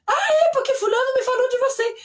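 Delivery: loud, very high exclamation; retold excited recognition. Party guest telling her someone spoke of her, acted out excitedly. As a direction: play the thrilled guest, pitch way up.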